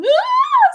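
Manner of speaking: put-on high piercing child's scream; retold scream. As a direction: shriek like a hurt little girl. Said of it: "I hear her scream".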